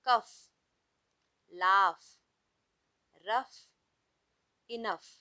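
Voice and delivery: teacher reading gh words aloud. Shown as a demonstration. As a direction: model each word loudly with a clear f ending. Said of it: "I am going to read".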